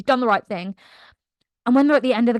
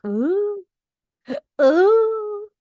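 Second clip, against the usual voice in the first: high mock ooh; 11 semitones higher than usual. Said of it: "Astrid's like".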